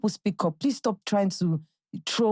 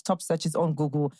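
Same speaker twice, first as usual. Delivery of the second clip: ordinary talk, nothing marked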